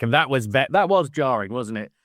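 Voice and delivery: ordinary talk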